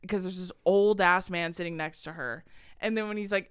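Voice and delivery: ordinary talk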